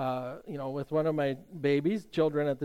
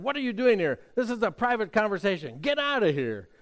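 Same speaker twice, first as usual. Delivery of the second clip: retold angry snarl, pitched up and louder; 6 semitones higher, 3 dB louder than usual. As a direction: snap it at an intruder, hostile. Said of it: "snarled and said".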